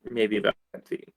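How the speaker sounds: low, soft, uncertain mumble; barely audible. Real, not acted. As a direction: trail off quietly as you guess. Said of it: "About what?".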